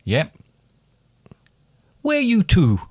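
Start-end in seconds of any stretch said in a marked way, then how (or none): 2.0-2.8 Welsh accent. Shown as a demonstration. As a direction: call it out high in Welsh.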